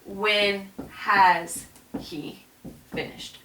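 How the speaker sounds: very slow, each word laid out; slow literal translation. Shown as a demonstration. Teacher dictating the literal translation to students, very slow. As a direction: spell out the French word order deliberately. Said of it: "But I want you to say".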